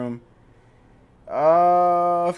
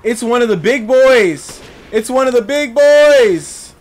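slow, loud, higher alarmed shout; panicked yell; 7 semitones higher, 9 dB louder, slower than usual. Real, not acted. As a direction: yell in real panic at the big enemy. Enemy appears, panic.

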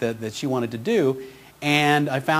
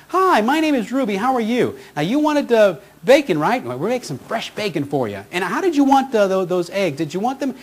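imitating Ruby in a much higher, cheerful voice; 6 semitones higher than usual. Imitating the drive-through lady offering him breakfast, higher cheerful voice. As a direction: put on Ruby's bright, higher drive-through voice. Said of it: "this lovely lady named Ruby says".

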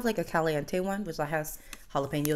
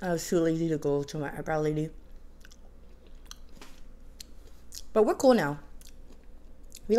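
chewing burger between words; muffled speech around food; talking with mouth full. Real, not acted. Seen 2: Bites burger repeatedly, cheeks full and chewing while speaking throughout.

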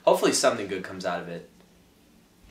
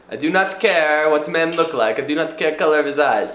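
put-on Russian accent; 6 semitones higher, 8 dB louder than usual. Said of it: "she responded with".

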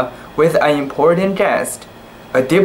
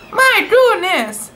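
much higher, squealed amazement; amazed exclamation. A narrator's reading. Tai Tai learning the kitten is royal, squealed amazement. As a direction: gasp it out in delight. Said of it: "exclaimed Tai Tai".

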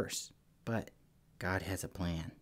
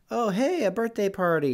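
high, louder mock party surprise; pleasantly surprised; 11 semitones higher, 4 dB louder than usual. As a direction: act lightly surprised at a party, pitched up high. Mild pleasant surprise contrasted with spooked.

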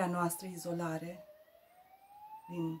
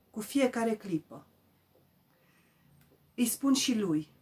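solemn poetry reading, projected, with measured pauses; recitare de poezie; 7 dB louder than usual. Acted. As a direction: recite the poem line by line, lifting the key words. Recites her poem. (recitare solemnă de poezie, cu pauze)

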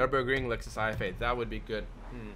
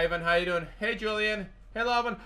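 usual speaking voice

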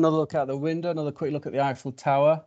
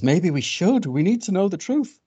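higher-pitched little bird; persuaded bird; 6 semitones higher, 4 dB louder than usual. Acted. Bird agreeing they need the truth, lightly urgent. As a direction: lift the pitch as if talked into it. Bird wavers towards fox's plan.

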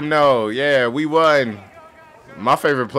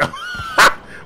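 high squeal laugh. Real, not acted. Funny moment in game.

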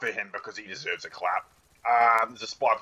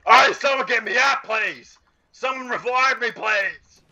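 high, loud cry for rescue; pleading yell; 6 dB louder, slower than usual. Real, not acted. Downed gamer begging teammates to revive him, pleading yell. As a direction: beg loudly, pitched way up.

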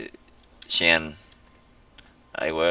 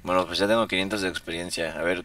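ordinary talk

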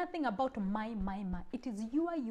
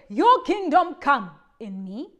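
prayer recited; 8 dB louder than usual. Shown as a demonstration. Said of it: "That is why we say".

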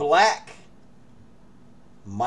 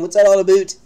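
teasing Canadian "don't you know, eh", louder and higher; mock Canadian accent, louder and higher; 7 semitones higher, 12 dB louder than usual. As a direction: rib him in a hammed-up Canadian accent. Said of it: "You chased out the Canadian".

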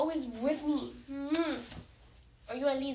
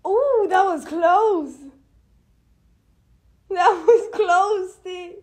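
loud slow amazed ooh; thrilled the dog nearly talked; excited; 3 semitones higher, 9 dB louder, slower than usual. Real, not acted. Cheering his dog's near-word, loud slow excitement.

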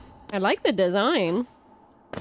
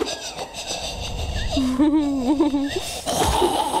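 shrieking laugh; 2 semitones higher than usual. Real, not acted. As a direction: laugh out in fright. Scared by enemies.

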